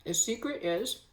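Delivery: talking while eating; words round a mouthful of food; mouth full. Real, not acted. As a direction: talk through a bite of scone. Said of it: "talking with my mouth full".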